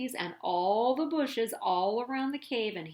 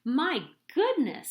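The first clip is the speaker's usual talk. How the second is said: higher and louder in wonder; amazed; 5 semitones higher, 5 dB louder than usual. A narrator's reading. The Grunt tribe marvelling at the huge egg, amazed. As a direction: gasp it out at the giant egg.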